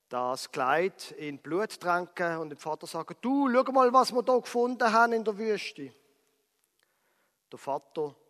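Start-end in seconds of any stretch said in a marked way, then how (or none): 3.2-5.9 acted alarm. Brothers show the father the dress.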